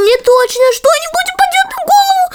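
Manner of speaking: high, fast frightened wail; panicking that something will fall; panicked cry. Acted. Crab panics about falling things.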